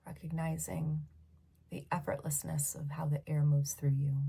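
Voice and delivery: hushed meditation voice with long pauses; guided meditation. Real, not acted. Leader guiding awareness from head to feet, hushed meditation voice. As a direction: guide them through the body, leaving long silences.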